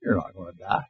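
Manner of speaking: low, soft, sly serpent; preacher doing Satan's sly whisper. Acted. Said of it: "Satan says".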